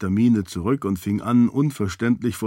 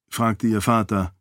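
plain reading voice

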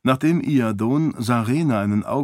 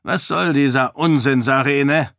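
irritated king; 4 semitones higher, 4 dB louder than usual. A narrator's reading. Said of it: "wollte der König wissen".

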